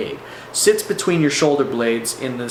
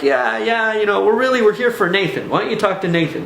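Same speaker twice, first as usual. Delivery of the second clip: imitated friend, higher-pitched and brushing it off; mock deflecting; 7 semitones higher than usual. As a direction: wave the attention away, pitched up. Acting out Dan deflecting attention.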